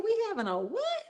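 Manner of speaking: can't believe what he's hearing; incredulous question. Acted. Older brother hearing there's a party, a high incredulous question.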